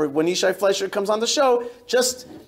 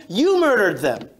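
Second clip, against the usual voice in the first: normal talking voice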